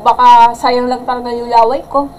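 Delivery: ordinary talk, nothing marked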